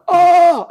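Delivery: high, loud drawn-out oh; loud yelled oh. Retold or imitated. Yelling to provoke the drill instructor, very loud. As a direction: yell it as loud as you can. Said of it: "as loud as I could".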